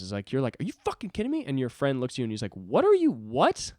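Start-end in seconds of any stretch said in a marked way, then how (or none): 0.6-1.4 high-pitched angry disbelief; mock outrage. Hothead to his friend, mock outrage. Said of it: "But that is anger issues". 2.6-3.7 high, loud mock bewilderment; baffled friend. Friend reacting to an outburst, baffled disbelief.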